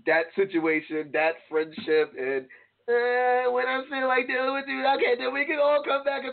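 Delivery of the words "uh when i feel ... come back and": high put-on voice of a controlling friend; pitched-up mocking imitation; mock control-freak friend. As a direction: imitate a friend who decides when everyone makes up.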